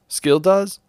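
puzzled question. Real, not acted.

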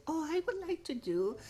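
daughter's sweet work voice, imitated high and soft; imitating a sweet, soft daughter. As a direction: play the gentle polite daughter on a work call. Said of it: "toda suave y toda dulce".

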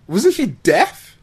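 ordinary talk, nothing marked

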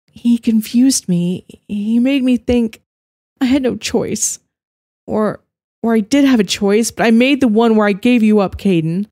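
high, pained and halting; anguished. A narrator's reading. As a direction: confess through guilt. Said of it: "she whispered".

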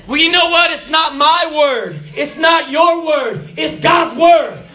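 high, driving shouted sermon; impassioned preaching. Acted. Preacher proclaims God's word to the congregation, impassioned shouted preaching. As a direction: preach at full cry, stretching the key words.

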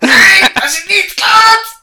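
high, loud, strained mock German shout; mock German accent. Player mocks German voices in a high hammy German squawk.

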